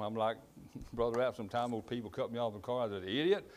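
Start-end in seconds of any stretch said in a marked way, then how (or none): 3.0-3.5 higher, mildly annoyed mock outburst; irritated retort. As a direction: imitate yourself griping at another driver. Retelling his reaction to drivers.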